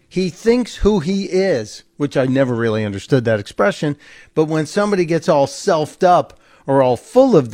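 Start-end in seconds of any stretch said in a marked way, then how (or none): 0.1-1.8 quoted saying. Retold or imitated. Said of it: "they would say".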